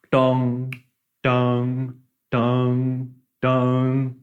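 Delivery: sustained level "dong" on one note; imitated gong. Shown as a demonstration. Teacher recalls the traditional gong, imitated "dong". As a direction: intone evenly spaced, ringing gong strokes. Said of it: "the gong that was going".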